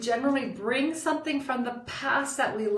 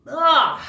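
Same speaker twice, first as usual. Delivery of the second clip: exasperated groan; 5 semitones higher, 10 dB louder than usual. Retold or imitated. Exasperated reaction to girlfriend.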